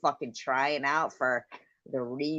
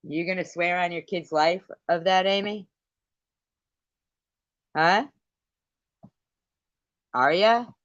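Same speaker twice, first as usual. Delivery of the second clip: loud, high demanding shouts; furious challenge; 6 semitones higher, 7 dB louder than usual. Real, not acted. Streamer shouts demands at her rival across the live stream. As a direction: push her for an answer.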